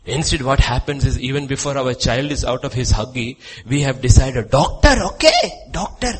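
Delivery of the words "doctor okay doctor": eager parent. Pretend. Parents deciding child's career.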